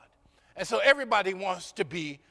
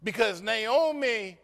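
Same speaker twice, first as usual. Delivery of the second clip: ordinary talk, nothing marked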